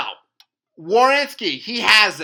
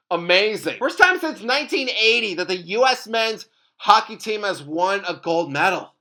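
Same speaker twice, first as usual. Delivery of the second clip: ordinary talk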